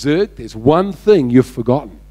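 low, assured delivery; confident hero. Acted. Buzz confronts his captor before execution, low and confident. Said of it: "with an air of confidence".

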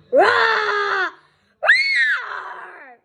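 loud high roaring cry; tiger roar. A narrator's reading. The van computer roars like a tiger, loud.

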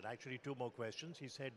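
normal talking voice

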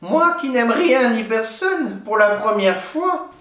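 put-on an old hag surprised by her own heart; softened witch. A narrator's reading. Witch holding the sleeping baby, slow softened crone voice. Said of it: "Alors elle se dit".

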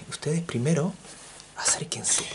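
hushed beckoning whisper. Acted. Teacher calling students nearer, whispering. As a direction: draw them in with a whisper.

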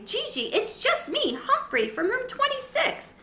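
high reassuring squeak; calm her down, still shaken; squeaky hamster. A narrator's reading. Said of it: "managed to take a deep breath".

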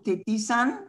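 Titisan rising like a question; rising intonation. Retold or imitated. Teacher echoing a student's question, Titisan with rising intonation. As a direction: lift the end of TITISAN into a question. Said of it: "with the rising intonation".

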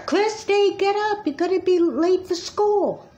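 high-pitched wake-up call to a sleepy daughter; calling upstairs. Acted. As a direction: rouse a late sleeper from below. Mom calling daughter from downstairs.

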